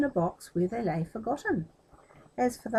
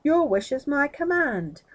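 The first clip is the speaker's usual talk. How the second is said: booming, louder genie voice; a grand genie proclaiming service; 3 semitones higher, 6 dB louder than usual. Acted. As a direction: announce yourself like a mighty genie. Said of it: "I am the genie of the ring".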